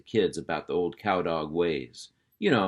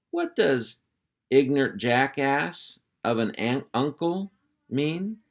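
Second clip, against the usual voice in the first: put-on high, slow, curious girl voice; a puppy asking what a rude word means; innocent little girl; 6 semitones higher, slower than usual. Barbara innocently asking her uncle, high curious girl voice.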